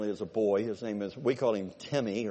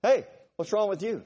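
mock runner taunting; 7 semitones higher, 6 dB louder than usual. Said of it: "they go".

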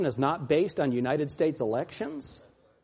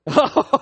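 high gleeful mock laugh; 7 semitones higher, 8 dB louder than usual. Preacher mocking the damned with a laugh. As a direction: cackle with mocking glee. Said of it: "He's gonna laugh".

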